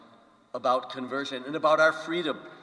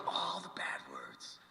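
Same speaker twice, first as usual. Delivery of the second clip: hushed, drawn-out stage whisper; scandalized whisper; 11 dB quieter, slower than usual. Retold or imitated. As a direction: whisper it slowly like something forbidden. Mock-scandalized retelling of forbidden swearing.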